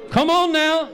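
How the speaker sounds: preacher climbing into a fervent call. Real, not acted. Preacher urging the crowd.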